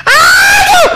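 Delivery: very high, drawn-out cry of dismay; embarrassed wail. Real, not acted. Host embarrassed by talk of Indonesian football, high wail. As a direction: wail it high, then let it drop.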